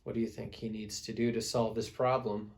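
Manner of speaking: usual speaking voice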